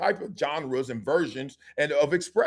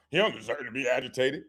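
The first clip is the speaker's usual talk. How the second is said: low mock-sympathetic quote; mocking echo; 6 semitones lower, 4 dB quieter than usual. Turning the pundit's line onto a man, mocking. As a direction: parrot the line low, in mockery.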